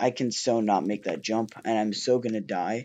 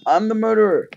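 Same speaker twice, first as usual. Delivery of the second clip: excited; 10 semitones higher, 9 dB louder than usual. Real, not acted. Repeats it higher and louder.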